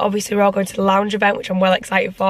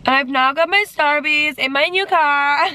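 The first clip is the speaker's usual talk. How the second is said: gleeful singsong; 6 semitones higher than usual. Real, not acted. Showing off Starbucks in her new car, gleeful singsong. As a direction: sing it out with high-pitched glee.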